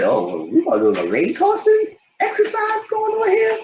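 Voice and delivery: very high sarcastic squeal; a shrill mock-amused quip.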